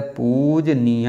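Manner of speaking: पूजनीय stretched, with a long first "poo"; slow spelling demo. Shown as a demonstration. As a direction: sound out पूजनीय slowly for spelling. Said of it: "ऐसे लिखा जाता है".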